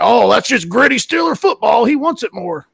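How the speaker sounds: mocking Steelers fans. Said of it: "And they're like".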